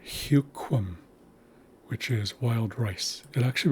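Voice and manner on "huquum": Huquum with a y-glide, hyu-kwum; Haisla word demo. Shown as a demonstration. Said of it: "And then we have huquum".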